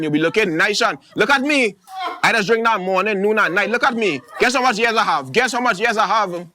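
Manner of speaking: high, loud, sure-of-herself prescribing; bossy bush-medicine auntie. Acted. Auntie pushing bush tea on a sick stranger, high and bossy. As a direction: sell the bush tea like gospel.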